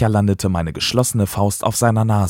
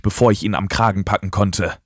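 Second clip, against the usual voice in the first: ordinary delivery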